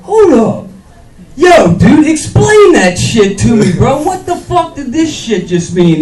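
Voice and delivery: high, loud confrontation; angry shout. Retold or imitated. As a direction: demand he explain himself, bro. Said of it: "and I was like".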